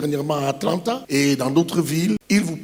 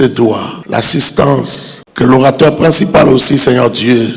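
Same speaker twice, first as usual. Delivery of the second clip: prayer; 4 semitones lower, 8 dB louder than usual. Acted. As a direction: pray over the assembly with fervour. Leads an opening prayer.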